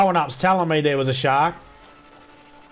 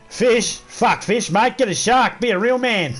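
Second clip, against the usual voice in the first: cocky Aussie bloke; 7 semitones higher, slower than usual. Retold or imitated. Lightly voiced Australian mocking the mafia's fish threat. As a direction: scoff at the threat like a tough local. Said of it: "And the Australia went".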